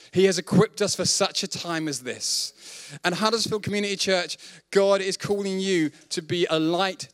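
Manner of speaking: earnest, rising preaching cadence; rally the congregation with conviction. Acted. Preaching charge to church.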